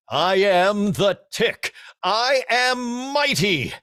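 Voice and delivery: high, loud, slow heroic bellow; big blustery cartoon hero voice; the Tick voice. Shown as a demonstration. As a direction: boom it out like a dim superhero. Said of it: "so I did it".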